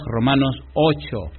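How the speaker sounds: ordinary talk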